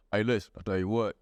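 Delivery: very low Southern country-singer voice; deep Southern baritone. Acted. Comic imitating a Southerner like the Oak Ridge Boys, deep baritone. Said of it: "when they have that deep baritone".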